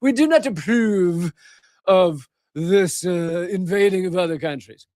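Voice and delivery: mock British accent. Host voicing Russia and China objecting to invasion, in a mock British accent. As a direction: declare it like a British diplomat. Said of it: "giving them a British accent".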